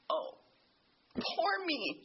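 imitating her own past self-pity, high on poor; mock sigh over yourself; self-pitying whine. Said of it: "walked around going".

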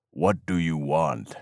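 a guarded warrior voice; cold demand. A narrator's reading. Gunman questions the intruder.

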